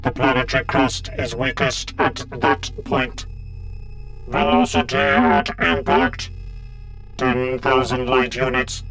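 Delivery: buzzing synthetic drone; robotic Cyberman. A narrator's reading. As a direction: recite data like a machine, evenly spaced. Cybermen exchange attack figures.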